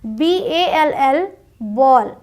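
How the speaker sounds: ordinary delivery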